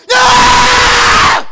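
full-force, high, drawn-out scream; loud outburst. Acted. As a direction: explode in a frustrated yell across the room. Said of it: "it's gonna be loud in a minute".

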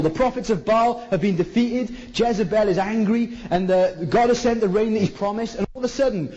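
usual speaking voice

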